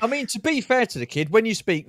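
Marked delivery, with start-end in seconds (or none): none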